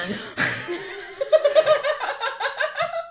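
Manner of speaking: high, sustained laugh; cracking up. Real, not acted. Table amused by the outstretched hand, laughing. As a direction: burst out laughing in a high peal. Player holds out hand for payment.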